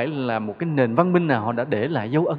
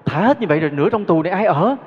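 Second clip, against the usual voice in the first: louder, higher mock outcry; la lên hốt hoảng; 4 semitones higher, 6 dB louder than usual. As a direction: cry out like the prisoners left behind. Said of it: "mới la lên". (kêu la thảng thốt, giọng cao và to)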